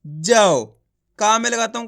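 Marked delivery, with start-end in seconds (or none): 0.1-0.6 जाओ said ja-au, au clear; pron demo. Shown as a demonstration. Teacher answers his own question with जाओ. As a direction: model जाओ with a full au. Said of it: "तो बोलिए, जा से क्या हो जाएगा?".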